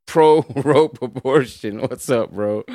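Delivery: ordinary delivery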